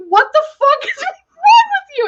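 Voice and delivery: high, loud, crying shout at herself; shocked shout. Retold or imitated. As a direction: yell it out in disbelief. Retells yelling at herself.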